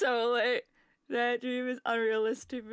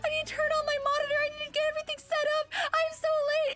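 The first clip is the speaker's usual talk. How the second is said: high, fast mock panic about being late; imitating her own frantic dream self, words tumbling out; panicked rush; 14 semitones higher, faster than usual.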